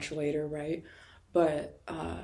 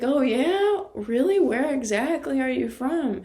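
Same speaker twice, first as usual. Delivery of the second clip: amused probing; 8 semitones higher, 6 dB louder than usual. Retold or imitated. Clerk playing along with fake accent.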